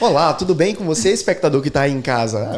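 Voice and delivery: loud, formal on-air welcome; hammed-up programme opening; mock TV presenter. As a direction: address the viewers like a stiff presenter. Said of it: "já pensou ele começar o podcast assim?".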